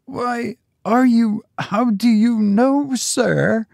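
very high, strained servant; aghast old retainer, voice pitched right up; horrified questioning. A narrator's reading. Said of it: "in a hoarse whisper".

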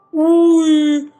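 a sudden loud uy; surprised exclaim. Real, not acted. Gamer seeing his gold reward, loud surprised uy. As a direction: blurt it out at the prize.

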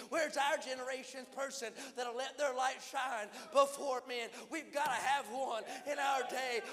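fervent revival preaching, pressing the crowd; preaching at full force. Real, not acted. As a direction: preach it hard and build the call. Altar call rising to a climax.

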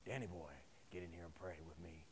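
put-on low, soft old man's summons; a low, gruff old Navy man beckoning; old mentor calling. Eighty-year-old mentor summons young Danny to pray, low. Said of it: "And he would say".

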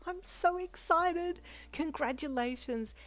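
high, drawn-out, delighted squeal; excited squeal. A narrator's reading. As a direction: squeal congratulations at your friend. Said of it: "she squealed excitedly in your ear".

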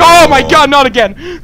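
high, loud cry of dismay; alarmed, dismayed shout; startled panic. Real, not acted. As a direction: yell out as the scare returns. Startled by monster returning.

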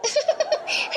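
a soft airy giggle; breathy giggle. Acted. Wife alone, giggling softly at her husband. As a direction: laugh quietly to yourself. Wife laughs after he leaves.

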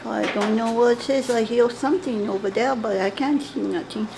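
normal talking voice

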